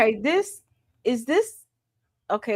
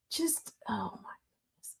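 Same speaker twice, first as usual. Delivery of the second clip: whisper; 14 dB quieter than usual. Real, not acted. Shocked at video.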